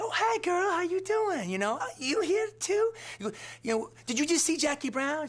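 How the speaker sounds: put-on high squealing girlfriend greeting; excited woman greeting friend. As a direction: gush like running into an old friend. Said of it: "she's like".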